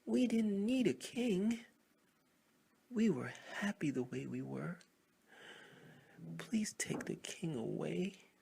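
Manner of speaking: high, loud wailing cry; tearful plea. Acted. Frogs beg heaven through tears to take the king away. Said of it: "Some cried in tears".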